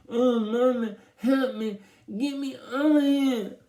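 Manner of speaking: high, loud muffled cry for help; stuck-lipped wailing. A narrator's reading. Byron stuck to the mirror begs Mama, high muffled wail. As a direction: wail as if your lips are stuck.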